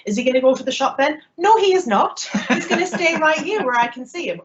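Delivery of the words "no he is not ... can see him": giggling protectively about her husband; laughing. Real, not acted. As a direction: laugh through a playful refusal. Joking about keeping Clark home.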